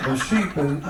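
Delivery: normal talking voice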